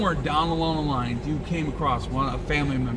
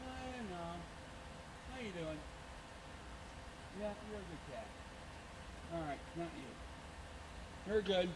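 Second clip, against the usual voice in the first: much softer, higher cooing; soft talk to a cat; 5 semitones higher, 20 dB quieter than usual. Real, not acted. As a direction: murmur gently to the cat. Talking softly to a cat.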